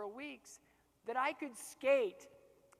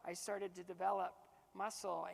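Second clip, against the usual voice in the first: ordinary talk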